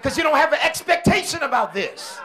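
high, loud, shouted preaching; full-throated revival preaching; preaching at full force. Acted. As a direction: drive each line home to the congregation.

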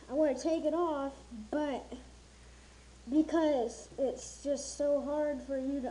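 muffled behind respirator; speaking inside face mask; through a gas mask. Real, not acted. Seen 2: Half-face respirator with twin filters worn over mouth throughout.

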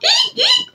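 tiny high squeak like a squeaky shoe; rubbery high squeaky toy. Pretend. Squeak recap.

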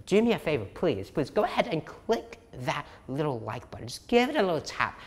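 ordinary talk

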